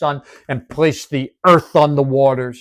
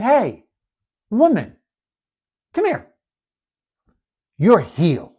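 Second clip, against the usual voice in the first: high, gentle call across the room; Jesus calling out; 8 semitones higher than usual. Retold or imitated. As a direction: call her over kindly, voice lifted high. Jesus calling the crippled woman.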